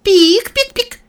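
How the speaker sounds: high, ceremonial squeak; solemn mouse squeak. Acted. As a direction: squeak it grandly like a queen. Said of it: "торжественно пропищала".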